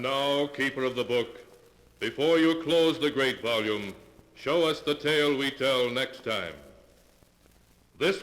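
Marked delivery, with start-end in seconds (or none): none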